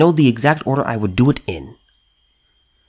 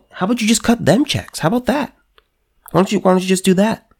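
much higher, mocking offer that falls; sarcastic suggestion; 7 semitones higher than usual. Real, not acted. Mocking the payout to looters, with high sarcasm. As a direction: sneer the suggestion.

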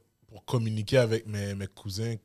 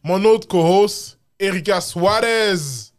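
loud, high, drawn-out introduction; show announcer; 8 semitones higher, 9 dB louder, slower than usual. Real, not acted. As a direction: build up the stars like a ring announcer. Présentation théâtrale de la coanimatrice. (présentation d'animateur, forte et étirée)

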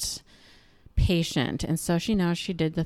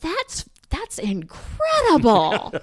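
high, drawn-out mock astonishment; amazed younger self; 8 semitones higher, 5 dB louder, slower than usual. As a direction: marvel in exaggerated disbelief. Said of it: "I remember thinking".

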